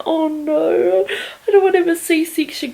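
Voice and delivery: high, tearful mock dread; imitated scared whine. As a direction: whimper it as if dreading it. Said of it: "I was just so scared".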